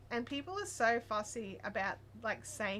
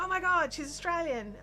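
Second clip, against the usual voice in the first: higher-pitched mock astonishment; amazed exclamation; 7 semitones higher, 6 dB louder than usual. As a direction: imitate someone excitedly discovering a novelty. Acting out someone's startled discovery.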